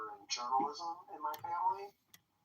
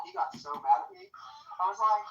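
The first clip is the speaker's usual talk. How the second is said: ordinary talk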